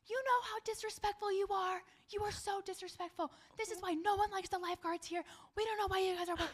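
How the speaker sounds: high, loud, furious tirade; imitating an irate woman yelling at a lifeguard, high and loud; angry customer. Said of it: "bashing me and swearing at me".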